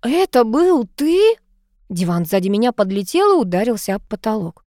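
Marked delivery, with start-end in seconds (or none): 0.0-1.4 high, drawn-out outraged question; indignant cry. A narrator's reading. Heroine realises Mark was the old man, indignant cry. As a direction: stretch the accusation and swing it upward. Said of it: "завопила возмущённо".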